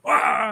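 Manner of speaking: high mock "aah"; imitated crowd cheer. Asking whether theater crowds still go wild, mock cheer. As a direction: imitate an audience going crazy, briefly. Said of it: "Do people go".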